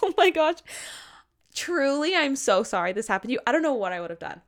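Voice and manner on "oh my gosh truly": ordinary talk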